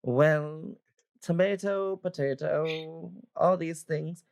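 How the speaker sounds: lightly voiced chiding older woman; mildly reproving character voice; scolding old adventurer woman. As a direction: primly teach them a lesson.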